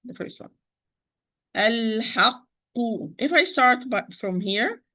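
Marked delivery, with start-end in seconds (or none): 1.6-3.1 "Al-haqqu" slowly, with a long closure on qq; held double qaf. Shown as a demonstration. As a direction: dwell on the qq of "Al-haqqu" before releasing u. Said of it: "you overlook this qaf".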